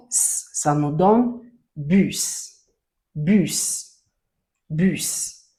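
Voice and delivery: drawn-out teacher sounding out; slow blending. Shown as a demonstration. Teacher to child, showing how bu and s make bus, slowly. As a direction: stretch it slowly so the sounds merge into a word. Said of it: "teacher blends bus slowly".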